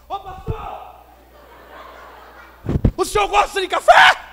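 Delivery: mock yell in a high, strained voice, held back in volume; high-pitched comic shout, as if volume could translate; imitated shouting to a foreigner. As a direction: imitate mother hollering to get through to someone who doesn't understand. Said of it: "fala português mais alto, não vira inglês".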